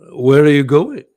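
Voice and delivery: loud retold call; calling after someone. Presenter calling after old colleague in studio, loud call. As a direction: call out to stop a wandering colleague.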